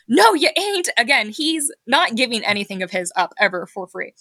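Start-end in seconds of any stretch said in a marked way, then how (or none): 0.1-0.9 imitated high-pitched defiant refusal. Retelling the shoe owner refusing, mock defiant. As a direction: push it up high, flatly refusing. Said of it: "he's like".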